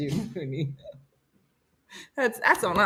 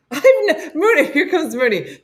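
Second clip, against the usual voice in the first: usual speaking voice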